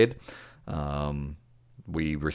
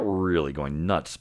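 ordinary talk, nothing marked

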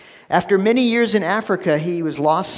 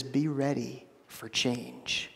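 slow and much softer, each word laid down; soft solemn quote; 4 semitones lower, 11 dB quieter, slower than usual. Retold or imitated. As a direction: hush it like a weighty word from God. God's quoted word to Israel.

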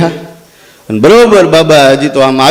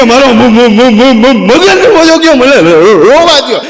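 mock sobbing; 6 semitones higher, slower than usual. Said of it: "તો એ રોતા રોતા બોલ્યો".

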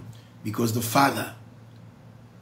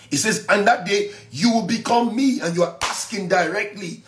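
raised, insistent sermon voice; fervent preaching; 5 semitones higher than usual. Acted. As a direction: press the point home.

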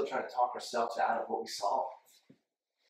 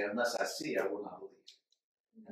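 quiet; 6 semitones lower, 6 dB quieter than usual. Retold or imitated. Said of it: "I say".

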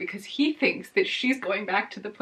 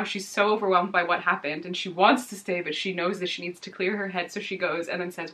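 usual speaking voice

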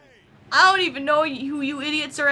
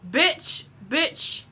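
normal talking voice

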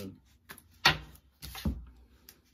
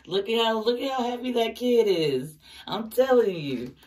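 excited; 8 semitones higher, 7 dB louder than usual. Real, not acted. Excited over the Sun card.

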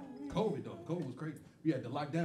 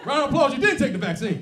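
loud crowd call; 5 semitones higher, 7 dB louder than usual. Shown as a demonstration. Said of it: "Watch this shit".

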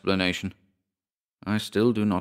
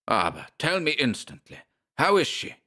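put-on high demanding German accent; urgent enraged German; 7 semitones higher than usual. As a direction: demand it, barely holding the rage. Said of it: "more enraged than I have ever seen him".

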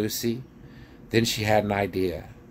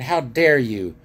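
loud, outraged dwarf voice, pitched up; angry raised-voice tirade; shouting dwarf; 5 semitones higher, 5 dB louder than usual. A narrator's reading. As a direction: scold loudly in outrage. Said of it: "he shouted".

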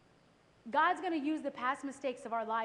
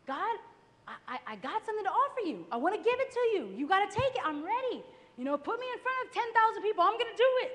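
eager plea; 6 semitones higher, 4 dB louder than usual. Retold or imitated. Eager pleading prayer to God.

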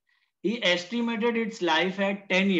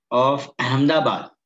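loud, slow dictation of the problem; dictating the question; 7 dB louder than usual. Acted. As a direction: read the question out word by word for students to copy. Reads the question aloud. (ज़ोर से धीरे धीरे लिखवाना)

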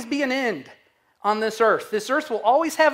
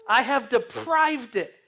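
usual speaking voice

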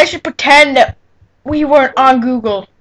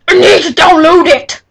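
louder and higher, exasperated; frustrated shout; 5 semitones higher, 6 dB louder than usual. Real, not acted. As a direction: blurt it out in frustration.

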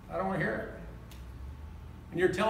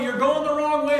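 loud, higher yell of "You're going the wrong way."; raised retold yell; 5 semitones higher, 6 dB louder than usual. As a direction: yell the warning at the car. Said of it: "people are yelling".

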